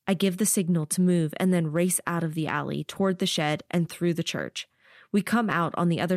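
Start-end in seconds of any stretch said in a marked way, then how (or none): none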